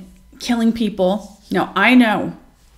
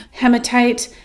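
usual speaking voice

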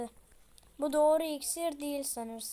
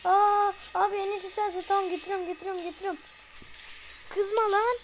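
frantic placating; 5 semitones higher, 4 dB louder, faster than usual. Real, not acted. Placating enraged baby.

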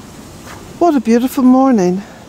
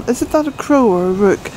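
usual speaking voice

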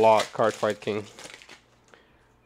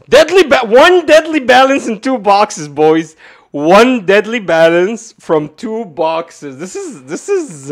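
loud, high, drawn-out complaint; outraged rant; 8 semitones higher, 10 dB louder, slower than usual. Real, not acted. Streamer complaining to viewers about two boxes, outraged shouting. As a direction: shout it slowly in disbelief, easing at the end. Ranting about poor pulls.

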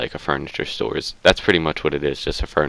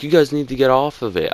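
higher pitched stern order; mock manager order; 8 semitones higher than usual. Store manager ordering the boys off the chairs. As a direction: imitate the manager laying down the rule. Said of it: "he was like".

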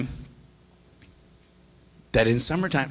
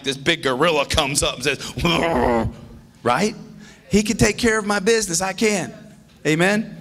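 fired-up pulpit delivery; preaching at full force; 11 semitones higher than usual. Acted. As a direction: preach it out to the congregation. Pastor preaching with fervor.

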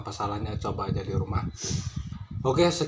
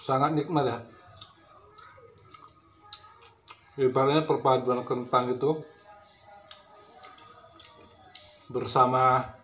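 words muffled between bites; chewing while talking. Real, not acted. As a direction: mouth partly full of food. Seen 0: Pursed lips and working jaw while picking at food throughout.

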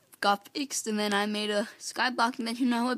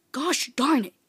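loud, higher mild curse; frustrated outburst; 5 semitones higher, 5 dB louder than usual. Real, not acted. Player losing a mob fight, frustrated outburst. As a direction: exclaim it in annoyance, pitched up.

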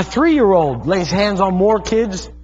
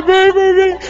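put-on much higher, tearful child's plea; imitating sobbing toddler; 12 semitones higher, 4 dB louder, slower than usual. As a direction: plead harder like a distraught toddler. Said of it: "And he's crying".

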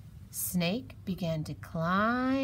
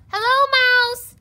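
high, loud holler; long-distance high shout; yelling down; 14 dB louder than usual. A narrator's reading. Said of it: "he yelled".

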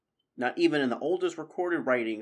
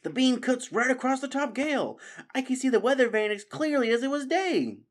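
much higher voice, eager; Constance wondering; 5 dB louder than usual. Acted. Constance telling friends what the beam shows, eager high voice. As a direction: describe the sight with excitement.

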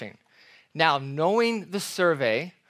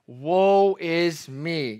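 very slow, stretched woe is me; drawn-out lament; much slower than usual. Acted. Preacher voicing Isaiah before God, drawn-out lament. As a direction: let it sink like a groan of dismay.

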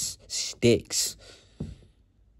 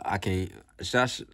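ordinary talk, nothing marked

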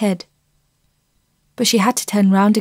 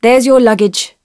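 a touch louder father voice; the father handing over luggage, a bit louder; father's voice; 6 dB louder than usual. A narrator's reading. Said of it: "said Fenella's father".

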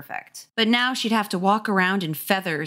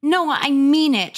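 loud, high, drawn-out sibling warning; louder big-sister scolding; exasperated warning; 8 semitones higher, 5 dB louder, slower than usual. Acted. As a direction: warn a brother sharply. Acted sibling quarrel.

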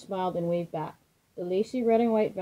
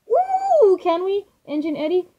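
high, loud pleading little train; excited begging; 11 semitones higher, 7 dB louder than usual. A narrator's reading. Youngest train begs the older trains for a party, high and loud. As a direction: beg like an overexcited child. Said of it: "Choo Choo Charlie begged".

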